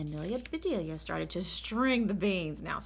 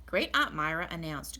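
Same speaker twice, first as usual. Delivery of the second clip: plain reading voice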